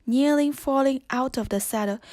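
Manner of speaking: plain reading voice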